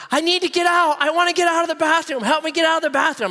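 put-on high, fast pleading of a scared kid; panicking kid. Trapped kid begging to be let out, high panicked plea. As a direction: voice the trapped boy begging to get out.

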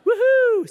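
very high, loud mock woohoo; hammed-up whoop of a gleeful dentist. Playing a gleeful dentist with needle.

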